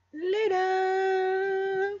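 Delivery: long held high farewell shout; loud sung-out later; loud high sign-off. Real, not acted. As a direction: belt the goodbye.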